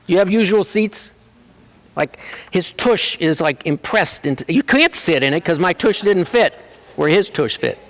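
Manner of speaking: normal talking voice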